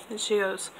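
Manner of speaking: ordinary talk, nothing marked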